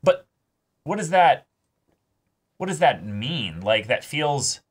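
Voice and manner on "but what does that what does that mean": puzzled and louder, pitched up; confused outburst, a touch hammed-up; baffled. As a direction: throw up your hands in mock confusion at the show. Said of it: "And I'm like".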